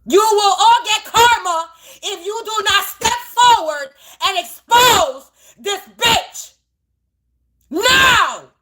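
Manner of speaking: loud, high, furious threat; furious shouting. Real, not acted. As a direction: yell it out as a raging warning. Threatening the people who wronged her.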